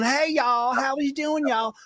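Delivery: high-pitched imitation of a Texas woman's hello; Connie Britton. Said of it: "with that Texas thing kinda going".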